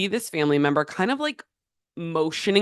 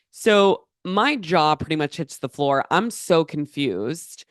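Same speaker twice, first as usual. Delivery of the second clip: ordinary delivery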